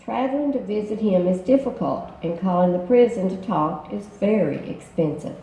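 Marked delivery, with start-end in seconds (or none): none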